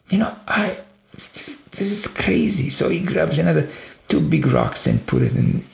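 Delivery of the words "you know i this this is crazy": exasperated. Retold or imitated. Father reacting to kids slamming doors, exasperated. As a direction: act out a dad at his wits' end.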